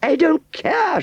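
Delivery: very high, loud shriek. A narrator's reading. Aunt Sally shrieking with delight at Wurzel, very high shriek. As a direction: shriek it out, too happy to care. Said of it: "shrieked Aunt Sally".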